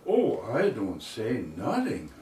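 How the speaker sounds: put-on John's German-accented reply, pitch swooping. John promises Henry he won't tell anybody, German accent. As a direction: imitate a German immigrant promising silence. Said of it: "his German accent there".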